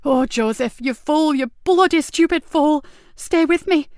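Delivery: high, loud frantic begging; distraught officer pleading over the wounded; desperate pleading. Acted. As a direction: hold him here with your voice. Nicky pleads with dying sergeant.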